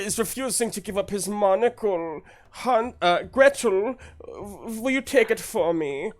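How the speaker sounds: high, fussy gentleman voice; flustered toff stammering politely; nervous posh man. Acted. Nervous man tells guard the little one won't give up monocle, fussy. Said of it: "I just enjoy doing these voices".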